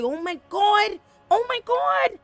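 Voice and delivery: high, loud, mock-scandalized squeal; scandalized gossip. Viewer reacting to the public family quarrel, scandalized squeal. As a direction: gasp it like juicy gossip. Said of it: "I say".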